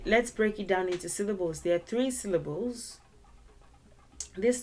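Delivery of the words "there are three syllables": ordinary talk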